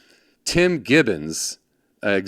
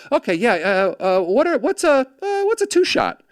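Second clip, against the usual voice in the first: mock clueless newbie; 11 semitones higher than usual. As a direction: pitch climbing higher, flustered and unsure. His clueless younger self asking.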